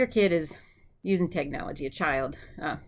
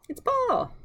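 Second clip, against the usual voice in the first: pitch. Real, not acted. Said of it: "Sounds like Paul".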